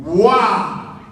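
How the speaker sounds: loud, amazed exclamation; retold burst of awe; awed wow. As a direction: blurt out a big amazed wow. Awestruck reaction to the announcer.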